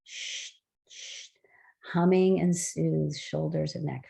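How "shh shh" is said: faint unvoiced shh like escaping steam; steam-release shh. Shown as a demonstration. Teacher showing the group how to let out steam, a faint shh. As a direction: let the steam hiss out softly. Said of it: "We're letting out the steam".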